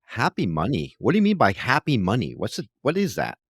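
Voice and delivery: normal talking voice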